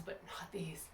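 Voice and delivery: breathy whispered aside. Real, not acted. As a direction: drop to a whisper. Aside during hard ab set.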